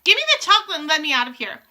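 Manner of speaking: fast, loud, high insistence; bratty boy's hurried yell; urgent demand. A narrator's reading. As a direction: rattle it off, demanding to leave. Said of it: "shouted Bruno".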